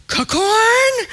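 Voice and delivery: put-on "Cacorn" chirped high like a toddler saying popcorn; a delighted little boy asks his parents for "Cacorn" at the counter, high and eager; toddler mispronunciation. Toddler asking for popcorn, high-pitched "Cacorn". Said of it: "That's how he says popcorn".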